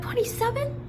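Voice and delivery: high incredulous question; shocked, pitch shooting up; stunned real disbelief. Real, not acted. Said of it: "twenty-seven years old".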